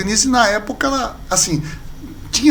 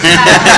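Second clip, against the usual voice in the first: loud burst of laughter; 9 dB louder than usual. Real, not acted. Pastor laughs before an inside joke, loud.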